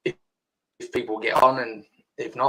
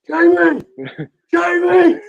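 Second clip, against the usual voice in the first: shouting a name across a crowd, much higher and louder; high hollered call of a name; pretend yelling name; 9 dB louder, slower than usual. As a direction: call him loudly from far away. Calling out to a friend.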